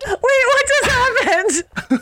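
real laughing shriek, very high; high, loud, cracking up; baffled hysterics. Real, not acted. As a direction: squeal it slowly through helpless laughter. Baffled hysterics after botched accent.

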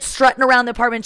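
usual speaking voice

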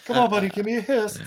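coaxing a pet through laughter; mock-sweet coaxing with a laugh. Coaxing a snake as Nick Cage.

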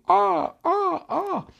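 very high, repeated squawks; bird cries. Acted. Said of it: "Ella vuela como un pájaro". (graznidos agudos de pájaro)

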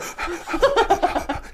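quick, short puppy pants; dog-like panting. Shown as a demonstration. Showing friends short breathing on rides, panting. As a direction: pant in short puffs like a little dog. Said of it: "eu respiro que nem cachorrinho".

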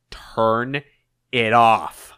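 TURN, pause, then a firmer IT OFF; mounting anger. Acted. Eggman cuts off Orbot's protest, anger mounting. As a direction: split the order with a seething pause. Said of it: "ever increasing in his anger".

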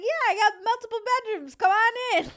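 high, loud and fast invitation; loud eager welcome. Retold or imitated. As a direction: shout it up high and quick. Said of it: "he was like".

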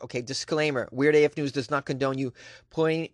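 ordinary delivery

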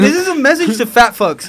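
laughing through the line. Real, not acted. Repeating, laughing.

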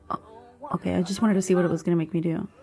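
ordinary talk

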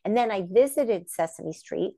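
normal talking voice

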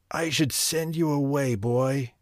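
a hint of the stern wizard, held in; the sorcerer scolding Franz, understated anger; lightly voiced angry sorcerer. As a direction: scold the apprentice gravely.

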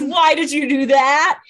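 a mock-upset vampire demanding answers; exasperated mock Damon. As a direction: play the frustrated brother, hammed up.

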